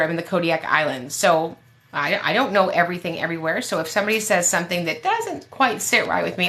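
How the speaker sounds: ordinary talk, nothing marked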